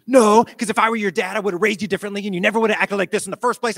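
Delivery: put-on furious principal putting kids in their place; angry yelling. Principal yelling at disrespectful boy, angry retold bark. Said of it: "I'm yelling, and I'm barking at the kids".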